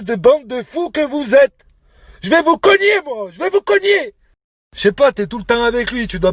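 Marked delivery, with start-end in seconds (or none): 2.2-4.1 high-pitched furious yell; angry threat. Real, not acted. Man threatening to hit the couple, angry yell.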